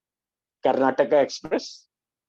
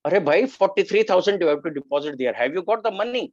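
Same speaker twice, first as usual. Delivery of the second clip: higher, louder, quick official's demand; brusque official; 7 semitones higher, 3 dB louder, much faster than usual. Retold or imitated. Hospital official telling the anxious son the deposit, brusquely. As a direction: rattle off the sum like a busy clerk.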